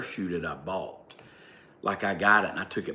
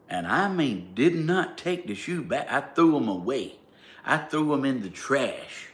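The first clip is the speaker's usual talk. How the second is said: defiant brag; 5 semitones higher, 4 dB louder than usual. Real, not acted. Defiant pride about trashing shoes.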